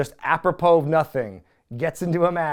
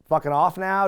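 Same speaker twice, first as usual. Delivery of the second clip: usual speaking voice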